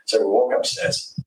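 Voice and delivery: ordinary talk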